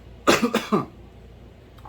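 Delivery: sharp, loud coughing fit; spicy cough. Real, not acted. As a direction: cough hard from the chili heat. Coughing after tasting hot sauce.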